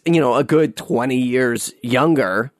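normal talking voice

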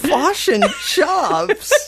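high, slow "Fish and chops", laughing; incredulous, high-pitched Kiwi "Fish and chops"; mock New Zealand vowels. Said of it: "a New Zealander would say".

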